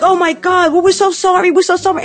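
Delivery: a lightly voiced, flustered high apology; hurried apology. Friends apologise to the waitress, lightly flustered. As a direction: babble sorry in a panic. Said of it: "they're like".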